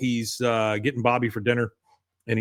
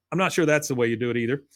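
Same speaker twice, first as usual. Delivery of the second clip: ordinary delivery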